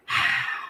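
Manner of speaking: mimicked sigh of exasperation; exasperated sigh. Retelling an official fed up with questioning her, exasperated sigh. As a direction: let out an exasperated breath. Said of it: "he was getting exasperated".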